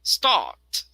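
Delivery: "start" as "staht", R dropped, British style; British pronunciation. Shown as a demonstration. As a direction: say "start" without the R. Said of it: "In British, it is".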